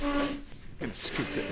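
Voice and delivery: hushed aside; whispering. Real, not acted. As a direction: whisper it under your breath. Leaning close to watch.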